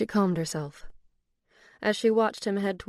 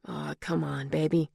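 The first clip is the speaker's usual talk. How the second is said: breathed; 2 semitones lower than usual. A narrator's reading. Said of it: "he breathed onto Olivia's neck".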